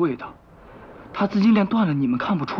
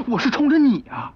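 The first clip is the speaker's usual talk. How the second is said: high, loud declaration; emphatic shout; 10 semitones higher, 4 dB louder than usual. Acted. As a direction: throw it at him. Declares his aim emphatically.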